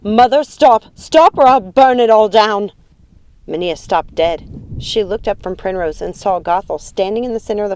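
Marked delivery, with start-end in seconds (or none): 0.0-2.7 loud, desperate scream at mother; shouted ultimatum; screamed threat. A narrator's reading. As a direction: yell the threat at full volume. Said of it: "screamed at her mother".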